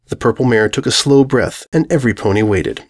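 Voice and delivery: plain reading voice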